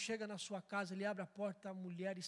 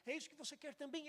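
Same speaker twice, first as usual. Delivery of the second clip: crying; 7 semitones higher, 4 dB quieter than usual. Acted.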